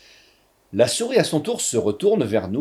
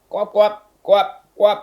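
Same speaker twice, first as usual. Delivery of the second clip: loud, high frog croaks; hiccupping croak; 6 semitones higher, 6 dB louder, slower than usual. A narrator's reading. Tied-up frog glaring at the boy, hiccupping croaks. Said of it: "en hoquetant".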